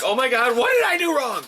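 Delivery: high-pitched, rising panic, a hammed-up lost puppy; whiny Roman Reigns. Roman Reigns lost after the match, high panicked whine. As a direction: play it like a lost puppy pleading. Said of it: "he's like".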